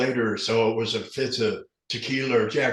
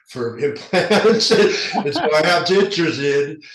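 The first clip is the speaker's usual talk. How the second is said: laughing it out, slow and louder; 4 semitones higher, 7 dB louder, slower than usual. Real, not acted. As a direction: chuckle through the words. Laughs about his dentures.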